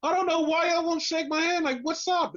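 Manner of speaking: high-pitched mock complaint; imitating Cash. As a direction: imitate a wrestler protesting a refused handshake, high. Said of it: "and he's like".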